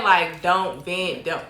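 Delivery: each word slowed and pressed; slow emphatic. Real, not acted. Correcting herself to the audience, slow and firm. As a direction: spell it out slowly so they hear it. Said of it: "Now, I'm not saying".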